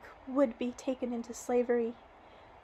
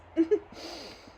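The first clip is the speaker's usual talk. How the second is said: quick breathy laugh; a brief amused laugh; short laugh; 4 semitones higher, 4 dB louder than usual. Real, not acted. Laughing at losing her voice.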